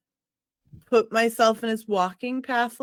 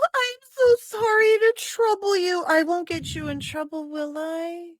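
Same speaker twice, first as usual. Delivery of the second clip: high gasping damsel voice; mock teary breathy damsel; helpless damsel; 8 semitones higher, 3 dB louder than usual. Player acts helpless damsel to guard.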